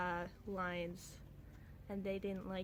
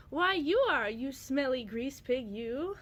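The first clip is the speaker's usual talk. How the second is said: higher, louder hammed-up insult; mock insult; 3 semitones higher, 7 dB louder than usual. A character in her comic mock-insults someone, half-hearted. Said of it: "and he says".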